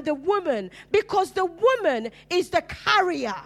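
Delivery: high, loud preacher's cry, slowing down on the threats; fiery preaching. Acted. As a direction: preach it with full force to the congregation. Preaching at full force.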